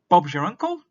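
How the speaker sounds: high, loud "Bob's your uncle" tossed off; cheery British saying. Shown as a demonstration. Teacher acting a British saying, high and loud. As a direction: announce it like a done deal. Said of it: "We say".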